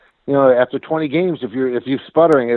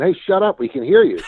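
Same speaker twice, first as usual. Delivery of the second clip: higher-pitched mock yell; retold yell; 6 semitones higher than usual. Player yelling up at the announcer's booth, retold mock yell. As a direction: imitate yelling up, without full volume. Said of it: "yelled up at Thorne".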